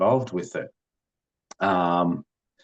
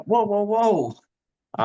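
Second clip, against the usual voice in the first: high, drawn-out whoas; mock alarmed backing-off; 12 semitones higher, 4 dB louder, much slower than usual. Husband reacting to his upset wife, exaggerated high alarm. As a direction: imitate someone trying to slow an outburst, much higher and stretched out. Retold reaction to wife's outburst.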